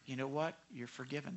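the pastor's real answer, a tender "you're forgiven" to someone apologizing; gentle forgiveness. Shown as a demonstration. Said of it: "I say".

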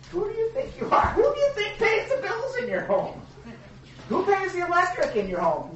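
high-pitched rhetorical scolding; lightly hammed-up parent lecturing a teen; mock scolding parent.

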